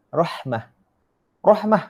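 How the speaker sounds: "Rahmah" with a full breathy Arabic h; Arabic pronunciation. Shown as a demonstration. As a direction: say "Rahmah" with an audible h before the m.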